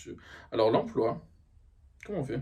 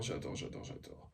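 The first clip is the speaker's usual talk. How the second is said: whispered; 14 dB quieter than usual. Acted. Repeated delight fading out.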